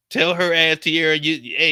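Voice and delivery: loud, urgent call. Pretend. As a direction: yell across the room at her. Calling out to someone.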